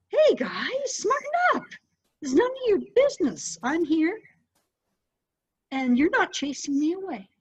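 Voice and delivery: high, sharp telling-off; scolding. Retold or imitated. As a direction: put the noisy birds in their place. Scolding squabbling crows.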